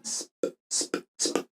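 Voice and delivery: sp as a voiceless hiss into a popped p; pure s cluster. Shown as a demonstration. Teacher drills the cluster, bare voiceless sp. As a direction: release sp with no vowel before it. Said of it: "no tenemos que poner una E".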